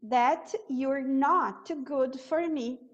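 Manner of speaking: that you're not good for me, bem devagar; slow corrected demo. Shown as a demonstration. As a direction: say the corrected line slowly. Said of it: "O correto seria a gente usar um not aqui". (versão correta dita devagar)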